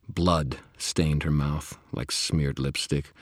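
ordinary delivery, nothing marked

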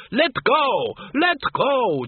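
very high-pitched acted scream. A narrator's reading. Doreen screaming at Arthur on the runaway trolley, panicked. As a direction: shriek it in panic, octave up. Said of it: "Doreen screamed".

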